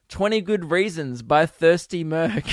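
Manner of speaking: ordinary talk, nothing marked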